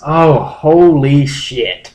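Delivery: drawn-out stunned disbelief; shocked. Real, not acted. Learning his cohost found the old Dare forums post, stunned and loud.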